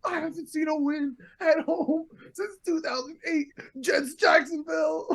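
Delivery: high, strained yell of a fed-up fan; jokey stadium scream, pitched high; mock fan scream. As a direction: holler it like a heckler wanting the crowd to hear. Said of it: "but he's screaming".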